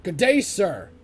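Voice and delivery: high loud indignant bark; hammed-up angry dismissal; mock outraged shout. Quoting famous movie line.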